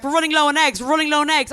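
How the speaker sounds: high, loud, panicky repetition; urgent mock announcement. Supermarket staff warning a shopper, in a mock-urgent high shout. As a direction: call it out high and loud like frantic shop staff.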